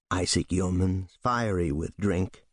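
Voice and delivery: ordinary delivery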